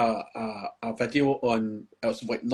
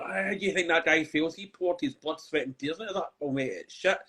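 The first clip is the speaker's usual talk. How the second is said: a quieter, whiny mock voice of an online critic; mocking a commenter; 3 semitones higher, 5 dB quieter than usual. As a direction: parody the commenter's pleading tone.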